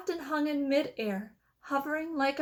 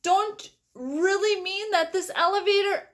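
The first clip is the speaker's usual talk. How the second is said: alarmed shout; 6 semitones higher than usual. A narrator's reading. Grandpa Joe questions Wonka in alarm, high anxious shout. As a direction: dread what the answer is. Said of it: "shouted Grandpa Joe".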